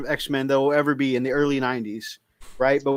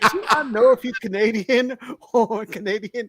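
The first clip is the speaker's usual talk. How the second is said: laughing disbelief; 7 semitones higher than usual. Retold or imitated. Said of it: "I was like".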